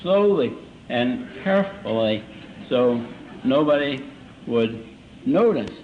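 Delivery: word by word with deliberate gaps; over-careful drunk trying to sound sober; slow, careful speech. Shown as a demonstration. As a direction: talk slowly and carefully, like a drunk hiding it. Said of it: "it would show up in my voice".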